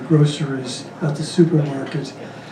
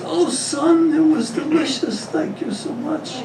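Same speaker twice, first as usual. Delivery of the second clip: put-on high, sweet old mother voice, trailing softer; 10 semitones higher, 5 dB quieter, slower than usual. Old mother thanking her son, high and sweet. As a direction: play a doddering old mom, pitched up.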